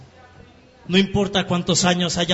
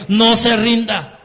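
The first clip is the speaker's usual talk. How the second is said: "No se rinda" called out higher and louder, slow and pressing; urgent plea; 6 semitones higher, 6 dB louder, slower than usual. Acted. Preacher urging families of addicts, higher and louder plea. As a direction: plead "No se rinda" at full voice.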